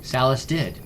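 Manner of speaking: ordinary delivery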